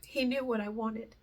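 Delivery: tearful, holding it together; choked up. Real, not acted. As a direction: voice lifting and thinning with real emotion. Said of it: "I was not gonna get emotional about that".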